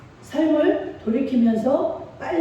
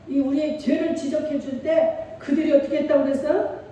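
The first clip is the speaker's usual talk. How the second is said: usual speaking voice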